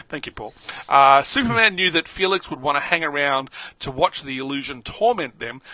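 ordinary talk, nothing marked

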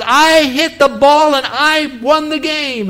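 put-on a boy bursting with his win; excited kid. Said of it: "And he's so excited".

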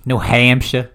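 New Hampshire ending in "shuh"; northern regional pronunciation. Shown as a demonstration. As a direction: drop the final r in "Hampshire".

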